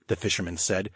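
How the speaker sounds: usual speaking voice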